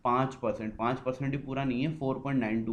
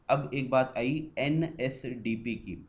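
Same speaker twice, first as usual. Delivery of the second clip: slow announcement; much slower than usual. Acted. Restates topic slowly.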